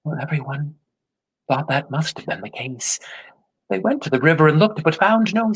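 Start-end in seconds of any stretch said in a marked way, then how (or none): none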